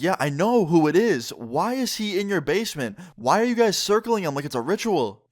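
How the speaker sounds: high-pitched, exasperated disbelief; incredulous questioning. Retold or imitated. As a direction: demand an explanation, pitch climbing. Retold baffled questioning of friend.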